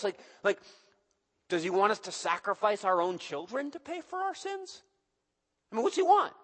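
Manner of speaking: high, exasperated questions; baffled and fed-up; confused people. Acted. People pleading with Micah, exasperated and confused.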